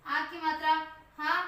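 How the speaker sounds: usual speaking voice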